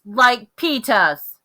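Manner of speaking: put-on loud, very slow, drawn-out child's demand; hollered demand. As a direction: holler it slowly, insisting. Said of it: "Fudge hollered".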